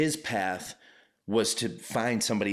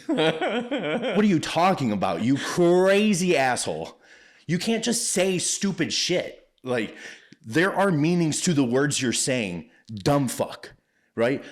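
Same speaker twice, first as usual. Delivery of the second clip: much higher, louder and slow, laughing disbelief; exasperated yell; 8 semitones higher, 6 dB louder, slower than usual. Retold or imitated. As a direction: let loose on the customer, laughing in disbelief. Imagined yelling at the customer.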